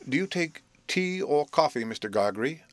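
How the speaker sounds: lightly voiced young gentleman; a hint of a polite host; Herbert's voice. A narrator's reading. Herbert offers breakfast.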